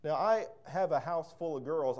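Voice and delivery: ordinary talk, nothing marked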